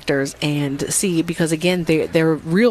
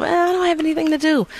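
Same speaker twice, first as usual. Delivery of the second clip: high-pitched, quick mock complaint; mock idle whine; 11 semitones higher, faster than usual. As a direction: voice someone at a loose end, pitched up. Voicing a bored would-be audience member.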